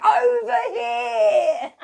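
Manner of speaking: high laughing shout across the room; calling out. Retold or imitated. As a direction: call out over the crowd, laughing. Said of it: "I'm like".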